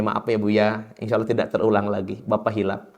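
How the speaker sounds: husband apologising softly to his wife after yelling; sheepish apology. Retold or imitated. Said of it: "kata suami kepada istrinya".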